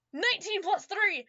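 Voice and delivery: high, slow triumphant shout of the roll; excited shout. Real, not acted. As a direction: yell the number out in triumph. Player celebrates a high roll.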